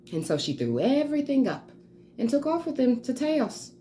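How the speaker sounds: exasperated mother laying down the law; a hint of theatrical parental reproach; lightly played stern mother. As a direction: lecture a wayward child, firm and deliberate with pointed pauses. Dramatic monologue as Grace, scolding mother.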